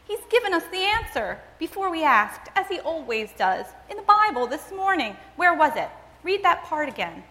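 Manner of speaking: high, quick, eager voice; excited. A narrator's reading. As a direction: bubble over with the answer. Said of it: "she said excitedly".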